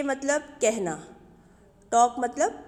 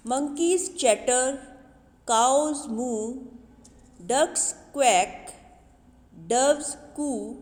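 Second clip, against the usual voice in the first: put-on loud, measured recital of a children's poem; poem recitation; 4 dB louder, much slower than usual. Teacher reciting the poem to her young pupils, loud and measured. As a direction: recite each animal line clearly for the class.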